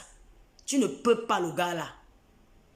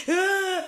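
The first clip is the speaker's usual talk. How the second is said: mock wail; 9 semitones higher, 4 dB louder than usual. Mocking her crying.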